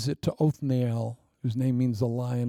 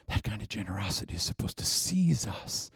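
much softer whisper; 5 semitones lower, 5 dB quieter than usual. Acted. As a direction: hush down to a whisper. Hushed sermon point.

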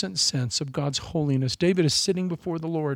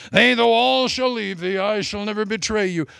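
boastful Peter; 3 semitones higher, 3 dB louder than usual. Acted. Voicing Peter's boastful promise.

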